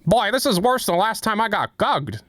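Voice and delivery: a lightly voiced Peter Griffin; Peter Griffin impression. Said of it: "I can't do any of those".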